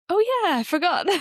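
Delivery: retelling her own startled realization; sudden surprise. Retold or imitated. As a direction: play the penny dropping out loud. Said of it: "I was like".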